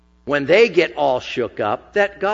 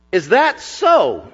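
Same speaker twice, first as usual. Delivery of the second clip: slow, higher scornful retort; retorting Pharaoh; 4 semitones higher, slower than usual. Acted. Pharaoh sneering back at Moses and Aaron, slow scornful retort. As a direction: sneer it back like a proud king. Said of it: "retorted Pharaoh".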